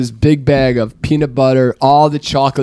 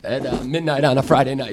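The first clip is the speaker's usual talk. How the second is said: ordinary talk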